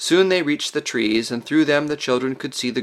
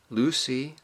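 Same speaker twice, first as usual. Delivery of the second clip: soft, timid call; softly, barely daring to speak; very small voice; 6 dB quieter than usual. A narrator's reading. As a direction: say it small and hesitant. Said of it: "said Susan in a very small voice".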